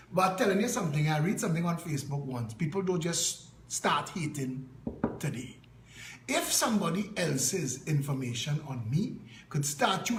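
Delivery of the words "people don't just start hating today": ordinary talk, nothing marked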